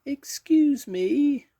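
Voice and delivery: tiny, very high, slow voice; very small voice. A narrator's reading. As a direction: squeak it out slowly as a timid little creature. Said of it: "in a very small voice".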